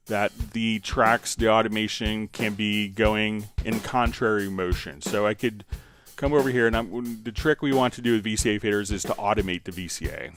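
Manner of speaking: normal talking voice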